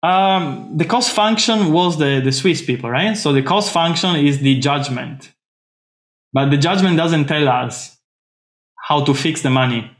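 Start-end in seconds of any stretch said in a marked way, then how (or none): none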